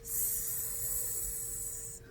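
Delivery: hissing like a snake; quiet snake hiss. Shown as a demonstration. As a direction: hiss the s out soft. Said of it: "make the sound like of a snake".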